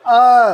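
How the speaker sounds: slow, loud, stretched अ; the Hindi vowel अ, stretched slow and loud for pupils; drawn-out vowel a. Shown as a demonstration. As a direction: hold the अ sound long and clear, like a teacher. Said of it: "जब आप अ बोल रहे हैं".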